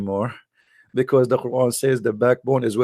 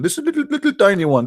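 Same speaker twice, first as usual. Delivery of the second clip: usual speaking voice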